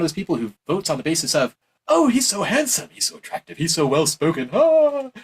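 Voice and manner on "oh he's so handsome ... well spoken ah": hammed-up smitten admirer; mock swooning. Voter gushing over a handsome candidate, mock swooning. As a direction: pretend gushing, ending in a high, loud sigh.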